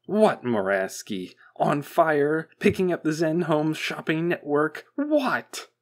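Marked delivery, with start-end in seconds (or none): 1.5-5.7 loud, rising exasperation to a high yell; exasperated. A narrator's reading. Boss guessing at the stammering technician's news, exasperated. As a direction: demand an answer, louder and higher.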